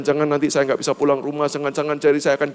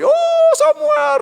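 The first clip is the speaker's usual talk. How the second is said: drawn-out falsetto melodrama; Korean drama mother; 4 dB louder, much slower than usual. Pretend. Mother running to her son as in K-drama, hammed-up wail. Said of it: "kayak drama Korea".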